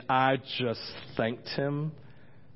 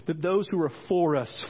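ordinary talk, nothing marked

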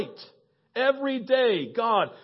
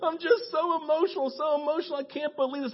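high, overcome prize winner; high-pitched emotional gushing; Betsy; 8 semitones higher than usual. Retold or imitated. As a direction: imitate a winner choked with emotion. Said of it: "you hear Betsy".

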